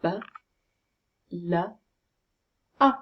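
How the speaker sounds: B, l, a sounded one by one; short B, short l, then a as in up; B... l... a, with wide pauses; sounds in isolation. Shown as a demonstration. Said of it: "Let's have a look at the sounds".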